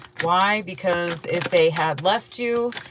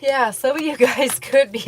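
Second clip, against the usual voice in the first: higher and louder as a yawn breaks the words; yawning mid-sentence; 7 semitones higher, 6 dB louder than usual. Real, not acted. Reader apologising to viewers while yawning mid-line. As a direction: let a yawn and a little laugh swallow the line. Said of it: "I don't know why I'm yawning".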